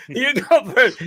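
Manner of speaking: laughing through the retold quote. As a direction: crack up while quoting yourself. Telling it while laughing.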